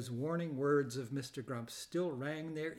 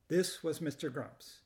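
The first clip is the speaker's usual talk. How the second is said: ordinary delivery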